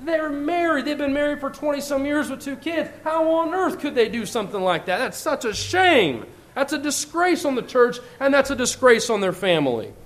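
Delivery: imitating a judgmental member, high mock scorn; contempt. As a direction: tut over someone else's shame. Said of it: "speak of others' sins with contempt and irritation".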